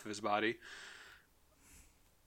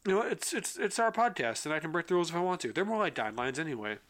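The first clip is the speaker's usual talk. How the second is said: playful; 6 semitones higher than usual. Real, not acted. Joking about breaking podcast rules.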